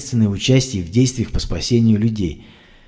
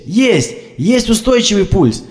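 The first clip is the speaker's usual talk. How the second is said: joyful shout; 9 semitones higher, slower than usual. A narrator's reading. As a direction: announce it with relief. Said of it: "кричала Яна".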